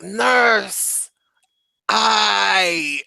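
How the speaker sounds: patient calling the nurse in pain. Retold or imitated. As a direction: moan for the nurse like the hurting patient. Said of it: "he's like".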